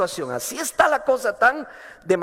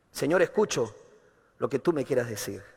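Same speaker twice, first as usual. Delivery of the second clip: humble prayer; 7 semitones lower, 6 dB quieter than usual. Retold or imitated.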